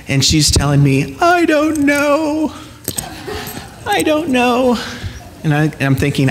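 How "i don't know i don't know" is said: put-on high, slow teary child voice; crying little girl. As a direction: play the upset five-year-old daughter.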